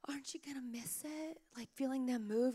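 mock-wistful hushed whisper; gasp-soft imitation of a sentimental mom; 轻声感叹. Imitating sentimental other moms.

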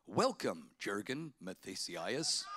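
lightly imitated automated voice, low and slow; mock the tax office robot greeting; machine voice. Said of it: "And they go".

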